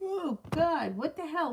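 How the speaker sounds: real surprise, as if caught off guard; startled outburst. Real, not acted. Reader catching herself mid-reading, startled and high.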